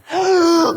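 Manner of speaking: sharp high gasp, jolting awake; a big startled gasp like coming back to life. Retold or imitated. Imitating waking up from the jolt, sharp high gasp. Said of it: "wakes up like that?".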